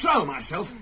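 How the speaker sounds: furious outburst flung back; angry shout. Acted. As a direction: throw the words back in a rage. Husband rages at the mystic.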